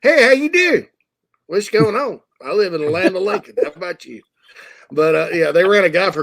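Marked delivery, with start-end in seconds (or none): 0.0-4.2 put-on high, loud folksy Central Illinois accent. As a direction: play up the Central Illinois twang like a small-town neighbor. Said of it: "the more they sound like us. they're like".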